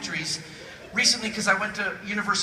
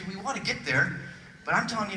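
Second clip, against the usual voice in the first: normal talking voice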